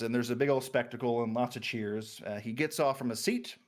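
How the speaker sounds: usual speaking voice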